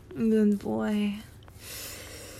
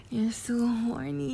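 normal talking voice

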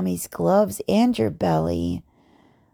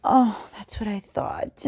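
ordinary talk

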